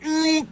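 high, drawn-out nauseated "mm"; comic high-pitched queasy hum; mock queasy groan. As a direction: imitate holding back vomit. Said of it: "trying to not throw up".

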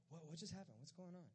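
quiet, confused disciples; hushed bewilderment. Acted. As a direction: ask it softly, at a loss. Disciples bewildered after Judas leaves.

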